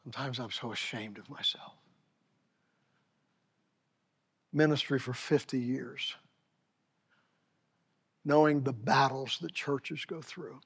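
barely above a breath; preacher ashamed before the congregation; hushed confession. Real, not acted. Broken, near-silent confession.

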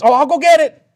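high, loud imitation of an eager helper; eager pastor. Pastor jumps up to help his hostess, high and eager. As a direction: jump in high and loud to volunteer.

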